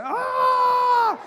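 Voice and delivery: long high mock scream; hammed-up shriek of terror; panicked scream. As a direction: scream as if about to crash. Retold driving into oncoming traffic.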